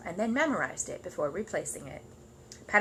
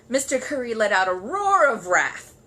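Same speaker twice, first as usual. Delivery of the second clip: loud telling; 4 semitones higher, 9 dB louder than usual. A narrator's reading. Narration of Curry's roar.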